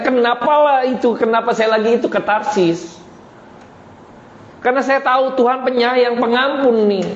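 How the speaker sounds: high-pitched, aggrieved protest; Yunus complaining to God. Acted. Yunus explains to God why he fled, high-pitched complaint. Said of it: "Yunus marah sama Tuhan".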